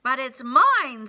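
loud indignant protest; mild storybook scream. A narrator's reading. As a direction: cry out with a punch, short of screaming. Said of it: "screamed the bird".

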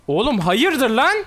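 high and loud, slow stretched hayırdır lan with a rising lan; loud indignant taunt. Real, not acted. Streamer taunting the enemy champion, loud rising hayırdır lan. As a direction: challenge him in outrage.

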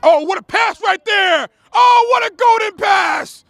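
high shouted rave, stretching out the last phrase; thrilled play-by-play. Real, not acted. Commentator raving over a golden pass, thrilled shout. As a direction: cry out in amazement, drawing it out. Live reaction to a great pass.

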